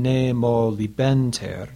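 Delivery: "Nemo libenter" read slowly, syllable by syllable, with a pause after; slow scansion. Shown as a demonstration. Teacher shows students the pause after the sense unit. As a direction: mark the caesura after libenter. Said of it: "there's a little pause there".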